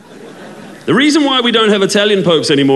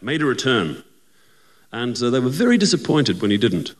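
usual speaking voice

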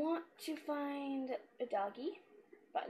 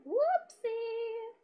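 playful whoopsie; 8 semitones higher, much slower than usual. Real, not acted. Playful slip in game.

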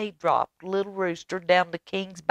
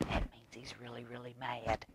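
whisper; 3 semitones lower, 15 dB quieter than usual. A narrator's reading. Aside to children.

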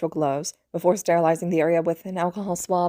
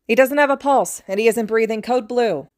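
urgent call, higher and louder; shouted; 5 semitones higher, 6 dB louder than usual. A narrator's reading. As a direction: raise the alarm across the room. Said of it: "Sakuma shouted".

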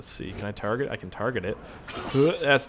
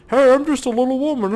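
much higher, louder mock little-woman voice; mock lady voice; 12 semitones higher, 6 dB louder than usual. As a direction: squeak it like a dainty lady, hammed up. Posing as woman in disguise.

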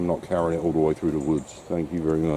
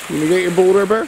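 high coaxing dog voice; 10 semitones higher, 5 dB louder, faster than usual. Real, not acted. Coaxing dog to fetch ball.

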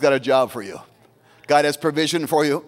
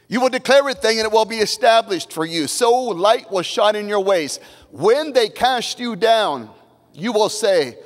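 raised, declaiming verse reading; fervent scripture reading; 5 semitones higher than usual. Acted. Pastor reading Job aloud to the church, fervent declaiming.

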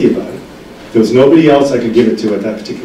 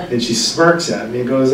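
usual speaking voice

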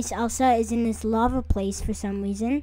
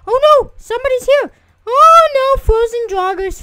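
much higher, louder alarmed shouts; panicked yell; 13 semitones higher, 12 dB louder than usual. Real, not acted. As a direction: yell in fright at the enemies. Enemies appear suddenly.

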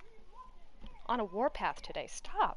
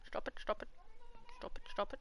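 soft whispered stop it, stop it; urgent whisper to the cat; whispered plea; 7 dB quieter, much faster than usual. Real, not acted. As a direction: hush the kitty under your breath. Hushing the cat.

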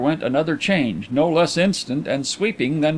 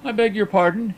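higher-pitched, stiff apology; 8 semitones higher than usual. A narrator's reading. Visitor reddens and apologizes to blind friend, stiff. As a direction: apologize formally, embarrassed. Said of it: "he concluded stiffly".

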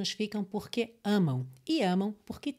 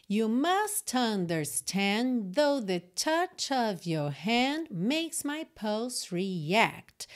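slow demo reading; much slower than usual. Shown as a demonstration. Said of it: "Já li esses três versos emendadinhos".

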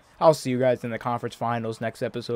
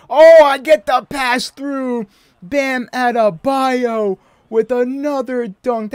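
slow, hyped announcer call; excited play-by-play; 9 semitones higher, 8 dB louder, slower than usual. Real, not acted. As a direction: call the dunk like a commentator. Hyped dunk call while playing.

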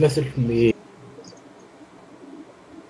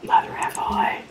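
whispering on the phone. Real, not acted. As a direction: breathe it without voice. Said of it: "Why are we whispering?".

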